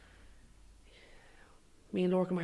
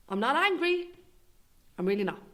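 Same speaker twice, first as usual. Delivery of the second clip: angry denial; 6 semitones higher, 5 dB louder than usual. Real, not acted.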